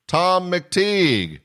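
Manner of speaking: high, slow, stretched name call; drawn-out announcement. Acted. Host bringing comedian on stage, drawn-out name call. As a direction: ring the name out like a ringmaster.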